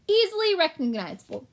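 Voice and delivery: a pompous little shopkeeper rat. Acted. Gilbert pleased.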